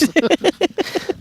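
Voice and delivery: high burst of laughter at himself. Real, not acted. Commentator laughing at his naming mistake, high. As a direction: laugh at his own slip.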